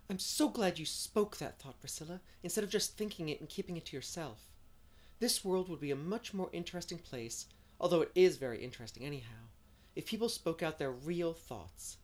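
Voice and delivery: eager, dreamy Anne. Acted. Anne planning a birthday outing in the spring, eager and dreamy.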